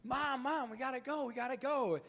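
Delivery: put-on high, loud kid's voice calling mom; child pulling at his mother, high and loud; child screaming. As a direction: play the impatient kid yelling for mom. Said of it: "her kid's in the background screaming".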